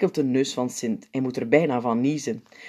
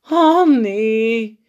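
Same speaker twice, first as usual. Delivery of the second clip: dismayed oh no; 8 semitones higher, 8 dB louder than usual. A narrator's reading. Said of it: "zucht de opperpiet".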